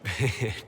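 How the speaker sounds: brief amused laugh; short chuckle. Acted. Amused Inquisitor answering a companion, short chuckle. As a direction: let out a small chuckle.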